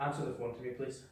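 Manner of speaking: ordinary talk, nothing marked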